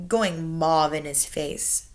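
usual speaking voice

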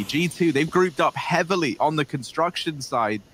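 normal talking voice

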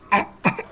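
a breathy pretend cough; fake cough. Sick child coughs for the receptionist, pretend cough.

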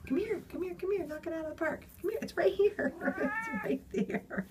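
high, soft calls to a cat; coaxing pet talk. Real, not acted. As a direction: coax the cat over in a high little voice.

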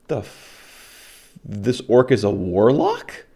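stunned slow disbelief, pitch leaping at the end; incredulous. Retold or imitated. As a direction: retell the party's baffled disbelief, ending on a high question. Party's disbelief at an orc warlock.

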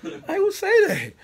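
high, laughing aside. Real, not acted. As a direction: squeal it through a laugh.

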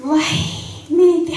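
high, delighted wai; excited exclamation. Acted. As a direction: squeal with hungry delight. Bride excited again. (高い声で喜ぶ嫁)

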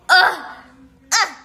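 loud frustrated groan; annoyed groan. Acted.

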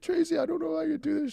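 high, thin mock whimper; tearful retold complaint. Patient retelling his plea to Tracy, tearful whine. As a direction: imitate yourself tearing up over breakfast. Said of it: "was tearing out my eyes as I was eating".

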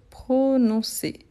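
"prononcer" slowly, in separate syllables; syllable-by-syllable dictation. Shown as a demonstration. Teacher dictating prononcer as it is written, slow syllable-by-syllable delivery. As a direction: draw out each syllable of "prononcer".

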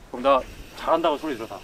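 ordinary talk

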